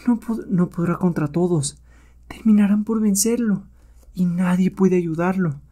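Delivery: high, soft voice of dread; worried onlooker. Acted. Companion watches the hero outnumbered by apes, worried.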